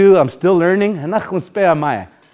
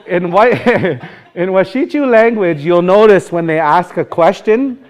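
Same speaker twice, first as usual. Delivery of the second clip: normal talking voice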